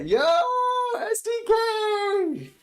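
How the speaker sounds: drawn-out, high, loud holler; real whooping hail, stretched high; excited shout-out. Real, not acted. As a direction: shout a long, hyped greeting. Hails a viewer mid-story.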